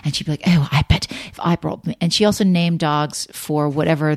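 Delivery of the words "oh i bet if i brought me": imitating her English mother in a light British accent; a quick British accent for mom's voice. Retelling her mother at dog shows, a light British accent. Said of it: "Did you just do a British accent with your mom?".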